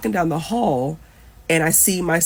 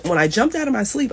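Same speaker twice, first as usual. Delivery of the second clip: ordinary delivery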